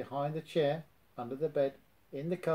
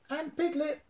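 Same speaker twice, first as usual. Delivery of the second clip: much higher sing-song call; Tigger calling; 13 semitones higher than usual. A narrator's reading. Tigger calls out to Piglet, high. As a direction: fling the name up high. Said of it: "Tigger called".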